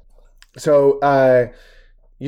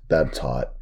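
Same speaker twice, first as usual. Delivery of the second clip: deadpan; 10 semitones lower than usual. Acted. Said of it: "Just monotone too".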